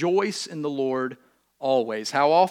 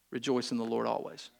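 quiet last words; 7 semitones lower, 10 dB quieter than usual. Pretend. Imagined final dying words.

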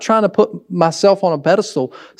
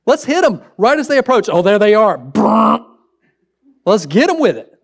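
gleeful plotting son; 4 semitones higher than usual. Acted. Older son plotting electrocution.

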